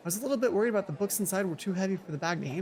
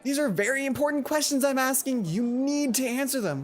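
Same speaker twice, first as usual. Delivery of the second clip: insistent friend; 7 semitones higher than usual. Acted. Mai insisting earnestly.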